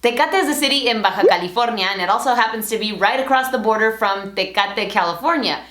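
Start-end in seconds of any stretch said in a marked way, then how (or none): none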